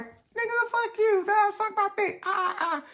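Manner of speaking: put-on high squeaky rude kid talking back. Rude schoolkid cursing at the dad, high squeaky voice. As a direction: squeak out a bratty insult. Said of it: "a couple of them was like".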